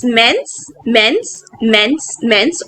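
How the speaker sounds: mince said slowly with a nasal vowel; slow French drill. Shown as a demonstration. Teacher modeling mince, slow and careful. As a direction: repeat mince slowly for learners. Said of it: "All the four adjectives have the same pronunciation".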